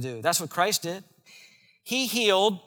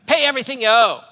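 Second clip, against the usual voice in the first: sharp, louder, higher demand for payment; angry demand; 5 semitones higher, 7 dB louder, faster than usual. Acted. Servant choking his debtor, angry demand. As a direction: bark it like a creditor grabbing a throat.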